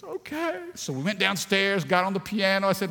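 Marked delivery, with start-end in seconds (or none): 0.0-0.6 put-on high little child's okay; little daughter. Daughter agreeing to the fish funeral, high childish voice. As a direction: agree like a small girl.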